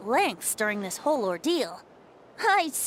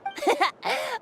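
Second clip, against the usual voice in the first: light chuckle; 11 semitones higher than usual. Acted. Venti chuckles before teasing.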